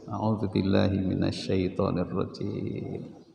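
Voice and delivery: murmured slowly as a prayer for refuge; slow, quiet recitation. Shown as a demonstration. Preacher showing the congregation how to seek refuge, slow quiet prayer. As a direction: recite it slowly and softly to calm yourself. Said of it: "Itu caranya begitu".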